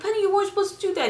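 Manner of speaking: fast, high scolding of a pet. Retold or imitated. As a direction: tell the dog off quickly. Scolding the dog in retold scene.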